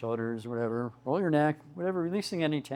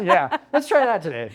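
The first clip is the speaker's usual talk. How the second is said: laughing; 6 semitones higher, 8 dB louder than usual. Real, not acted. Joking about holding breath.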